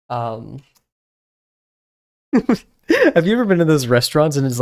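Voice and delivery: ordinary talk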